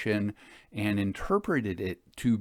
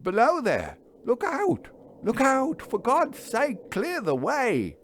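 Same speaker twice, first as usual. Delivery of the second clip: much higher, louder frantic warning cries; retold desperate call. Engine driver reenacts shouting at man on rails, frantic. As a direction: yell the warning down the track. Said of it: "I called out to him as loud as I could call".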